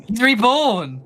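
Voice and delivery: high, loud exclamation; playful astonishment. Pretend. Friend hails a character's return, loudly amazed. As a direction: cry out in mock amazement.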